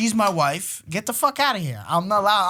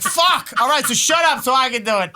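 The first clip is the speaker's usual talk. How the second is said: annoyed outburst; 7 semitones higher, 5 dB louder than usual. Retold or imitated. Acting himself annoyed at wife.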